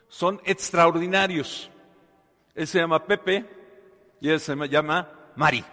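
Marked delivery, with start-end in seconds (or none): none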